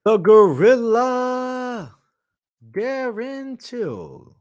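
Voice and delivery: high, very slow drawn-out fighter intro; ring announcer. Acted. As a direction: drag the name like a ring announcer.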